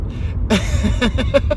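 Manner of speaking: light rising chuckle; a short high laugh to yourself. Real, not acted. Storyteller amused after listing the cats, laughing. Laughing at own closing remark.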